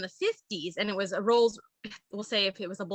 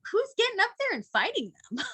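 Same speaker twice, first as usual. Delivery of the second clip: laughing disbelief; 10 semitones higher than usual. Retold or imitated. Incredulous laughing quote.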